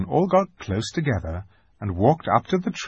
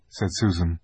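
ordinary delivery

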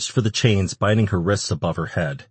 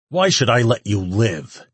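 raised, threatening question; demanding; 4 semitones higher, 4 dB louder than usual. A narrator's reading. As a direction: challenge the captive with force. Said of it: "he demanded".

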